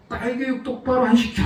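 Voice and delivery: angry scolding. Retold or imitated. Angry driver yelling at the father about his daughter, mock-furious. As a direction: bark it like an enraged stranger. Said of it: "하면서 막 욕을 하는데".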